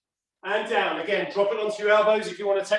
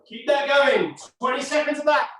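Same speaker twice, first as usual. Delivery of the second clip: barking gym coach; 7 semitones higher than usual. Real, not acted. Coach pushing class mid-exercise.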